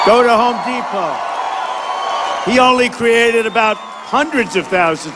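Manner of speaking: forceful rally oratory to a crowd; rally speech. Acted. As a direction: campaign speech, punchy and declaiming.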